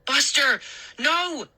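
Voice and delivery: high panicked scream at the dog. A narrator's reading. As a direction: yell to stop the dog. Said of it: "I screamed".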